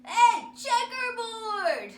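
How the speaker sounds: loud, slow drawn-out taunt; calling out. Acted. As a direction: call it across the room. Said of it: "Someone else called out".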